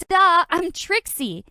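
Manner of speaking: slow loud exasperated drawl; exasperated duh. Acted. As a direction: roll your eyes, it's obvious. Exasperated answer.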